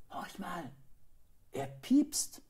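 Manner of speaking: hushed start leaping up high; excited boy's discovery; suddenly excited. A narrator's reading. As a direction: hush to listen, then pipe up high. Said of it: "sagte der Junge plötzlich aufgeregt".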